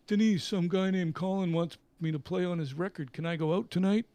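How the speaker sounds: loud, eager put-on voice; asking permission. Musician asking his wife to let him go out tonight, loud and eager. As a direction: beg the wife like a kid.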